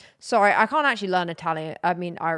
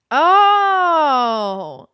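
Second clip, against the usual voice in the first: surprised oh; 8 semitones higher, 12 dB louder than usual. Real, not acted. Sudden realisation about pasta name.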